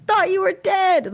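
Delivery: high-pitched mock alien promise; little creature voice. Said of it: "just like".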